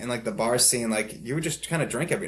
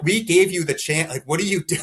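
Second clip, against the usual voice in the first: mock angry scolding, pitched up and louder; angry EMT; 6 semitones higher, 6 dB louder than usual. EMT scolding the drunk patient again, mock angry. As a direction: imitate the scolding EMT, half-hearted. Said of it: "they was like".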